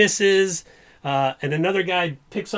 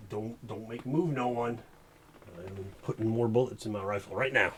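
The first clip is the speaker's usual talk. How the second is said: sheriff warning; 4 semitones lower, 8 dB quieter than usual. Acted. Sheriff warns from outside.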